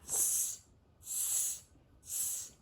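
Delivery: soft breathy tsk tsk tsk; cheeky monkeys tutting back. A narrator's reading. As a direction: monkeys mocking the peddler with soft tuts. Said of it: "and said".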